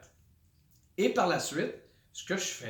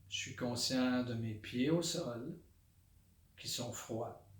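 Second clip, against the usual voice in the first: soft, low meditation voice with long pauses; hushed, unhurried body-scan guidance; guided meditation; 3 semitones lower, 8 dB quieter than usual. Shown as a demonstration. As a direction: settle into quiet, centred awareness, naming each sensation softly. Leads a body-scan meditation aloud.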